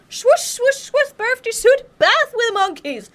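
high, slow, whooping mock hero voice; imitating brave bather. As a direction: ham up a cocky guy jumping into the bath. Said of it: "a brave dude I saw afterwards who was like".

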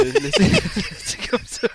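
laughing through the words; can't stop giggling. Real, not acted. Breaks up mid-sentence.

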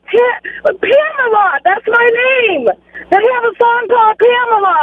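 loud, thrilled insistence; excited exclaiming. Real, not acted. Caller picking a Toto song, loudly thrilled. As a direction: belt it out with real excitement.

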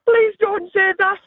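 high mock-pleading mum voice. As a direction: beg the child, a voice pitched well up. Mum scolding child, retold.

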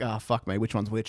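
low, soft aside; muttered confusion. Acted. Viewer muddling two villains' names, low confused mutter. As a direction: drop low and quiet, puzzled. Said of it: "and they're like".

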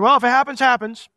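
shrugging husband. Retold or imitated. Husband brushing off wife's news, mock careless shrug. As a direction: toss it off like it doesn't matter. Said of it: "He could have just said".

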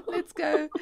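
real amused laugh carrying into the words. Real, not acted. Host moving on after the fanny farts remark, laughing.

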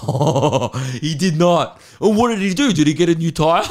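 high, loud, hammed-up amazement, breaking into a laugh; captivated mates. Mocking mates enthralled by a flat tyre story, exaggerated amazement. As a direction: gasp along as if the story is thrilling. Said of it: "he's captivating them".

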